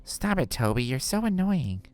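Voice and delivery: high-pitched whiny complaint; annoyed girl. Acted. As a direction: whine it high at an irritating cousin. Voicing annoyed Monica.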